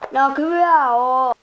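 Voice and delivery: loud, slow shout. Pretend. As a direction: yell it out. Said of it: "ei tarvi huutaa silti".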